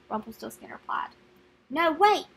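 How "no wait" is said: louder, higher anxious cry. Acted. King and queen begging Peter to stay, crying out. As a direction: call out to stop them. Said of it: "the king, queen cried".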